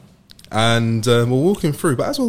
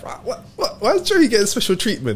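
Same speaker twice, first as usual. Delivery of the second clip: puzzled protest; 10 semitones higher than usual. Retold or imitated. Retold puzzlement at friend's treatment.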